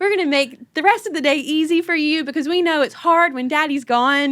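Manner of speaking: put-on insistent child-voice apology; imitating apologetic daughters. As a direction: rush to comfort Mom like young girls. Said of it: "my older two were like".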